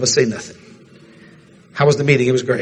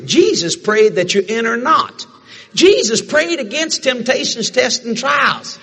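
preaching; 9 semitones higher than usual. Acted. Preaching point at full force.

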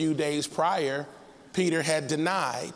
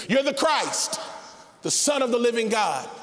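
a sudden, raised declaration; blurted out; 5 semitones higher than usual. Acted. Peter blurting to Jesus, sudden raised declaration. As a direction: blurt it out all at once. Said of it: "just blurted out the first thing that came to his spirit".

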